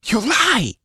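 high, venomous snarl; spat accusation. A narrator's reading. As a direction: spit it at her in fury. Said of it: "The accusation was spat".

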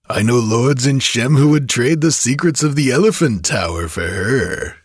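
coarse, boastful tavern braggart; drunken bragging rogue. A narrator's reading. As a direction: gloat like a drunk kidnapper. Kothian continues boasting.

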